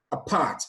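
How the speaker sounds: "Apart" with weight on "part"; stress on second syllable. Shown as a demonstration. Teacher giving an example of stress pattern, "Apart" stressed on "part". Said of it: "stress is placed on the syllable part".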